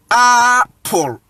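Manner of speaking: APPLE split as "AHH... pol"; drawn-out open "ah" starting APPLE; Akan A in APPLE. Shown as a demonstration. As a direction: say APPLE with a long "ah" first. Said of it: "the original pronunciation".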